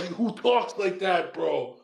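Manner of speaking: ordinary delivery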